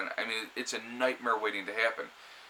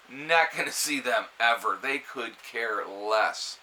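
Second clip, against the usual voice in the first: ordinary delivery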